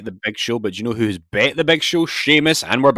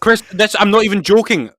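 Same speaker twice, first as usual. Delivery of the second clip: excited; 9 semitones higher, 6 dB louder than usual. Real, not acted. Stunned by a coincidence on TV.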